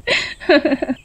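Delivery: high teasing giggle. A narrator's reading. Rupa giggles before teasing the sadhu. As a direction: giggle with mischief.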